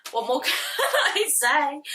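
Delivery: slowed by a laugh. Real, not acted. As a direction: laugh through it. Laughing while talking.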